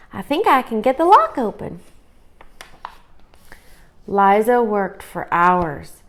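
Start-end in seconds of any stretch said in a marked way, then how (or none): 0.1-1.8 put-on higher, firm young girl; firm sister. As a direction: insist with confidence. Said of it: "said Liza firmly".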